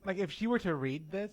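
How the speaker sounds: ordinary talk, nothing marked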